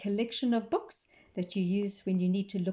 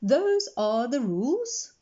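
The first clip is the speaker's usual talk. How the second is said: slow, louder laying down the law; stern; 5 semitones higher, 4 dB louder, slower than usual. Acted. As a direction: state the rules firmly. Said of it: "in a stern voice".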